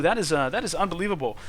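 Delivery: ordinary talk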